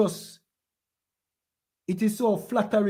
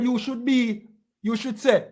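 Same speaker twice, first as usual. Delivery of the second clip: usual speaking voice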